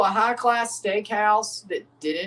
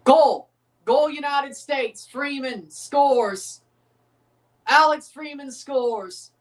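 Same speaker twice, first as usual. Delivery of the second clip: goal call; 5 semitones higher, slower than usual. Real, not acted. Watching the match, he calls the US goal in a drawn-out commentator cry. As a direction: belt it out like a TV commentator.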